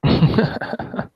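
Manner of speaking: short unvoiced chuckle; little airy laugh. Real, not acted. Laugh before introducing himself.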